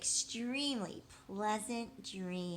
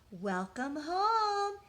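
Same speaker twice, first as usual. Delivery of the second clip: warm mother greeting; 7 semitones higher, 5 dB louder, much slower than usual. Acted. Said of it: "said her mother".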